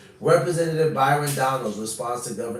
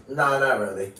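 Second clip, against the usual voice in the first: mock nonchalant dismissal; 3 semitones higher, 5 dB louder than usual. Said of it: "And he goes".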